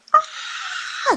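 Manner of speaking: sudden high breathy yelp; child's frustrated scream. Retold or imitated. Angry child bursting out during sibling fight, high scream. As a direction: burst out like a furious little kid. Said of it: "Akhirnya dia teriak-teriak dulu".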